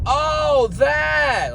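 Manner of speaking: very high, drawn-out "oh, that"; mock sudden realization. Girl finally understanding the water order, high-pitched mock realization. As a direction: squeal it like it finally dawned on you.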